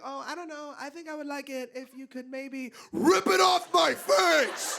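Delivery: tiny high voice bursting into a yell; sweet to savage. Retold or imitated. Sweet woman builds to shout.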